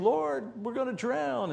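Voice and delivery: high frantic plea over the storm; panicked cry. Retold or imitated. Disciple crying out to Jesus, panicked and high.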